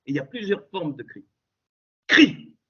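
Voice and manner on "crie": loud, high one-word shout; shouted command. Shown as a demonstration. Preacher urging the faithful to cry out, a loud high shout. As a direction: bark the word like a call to arms.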